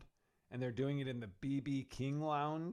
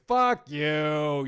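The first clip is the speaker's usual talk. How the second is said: mock angry outburst, louder; mock furious; 10 dB louder than usual. What a fired friend wouldn't want to hear, mock furious. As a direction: blurt an imagined curse loudly.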